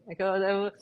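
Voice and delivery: ordinary talk, nothing marked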